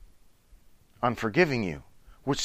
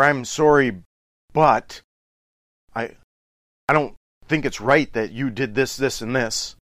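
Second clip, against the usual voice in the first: louder, defensive "I'm sorry, but"; defensive apology; 5 dB louder than usual. Retold or imitated. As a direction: justify yourself while apologizing.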